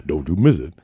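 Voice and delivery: lightly voiced stern old player; old man's warning. Old teammate warns the kicker before the kick, low mock growl. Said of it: "he walks up and he's like".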